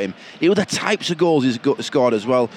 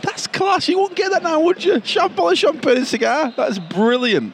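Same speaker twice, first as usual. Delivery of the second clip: excited; 12 semitones higher than usual. Real, not acted. Delighted by champagne story.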